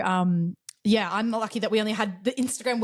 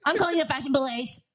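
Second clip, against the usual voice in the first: high-pitched, put-on scandalized voice; mock outraged busybody; 6 semitones higher than usual. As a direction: play an appalled onlooker making threats. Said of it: "No one is gonna ... be like".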